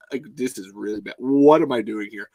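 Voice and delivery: normal talking voice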